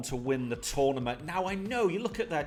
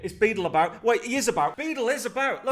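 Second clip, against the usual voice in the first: rising, louder game-show shout; excited reveal; 7 semitones higher, 5 dB louder than usual. Pretend. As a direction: announce the prank like a host.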